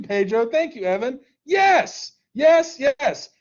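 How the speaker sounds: normal talking voice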